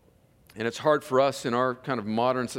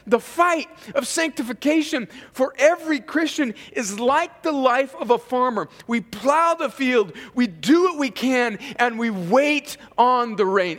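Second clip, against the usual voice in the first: higher, driving sermon delivery; impassioned preaching; 13 semitones higher, 7 dB louder than usual. Real, not acted. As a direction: preach it out to the whole room. Preaches at full force.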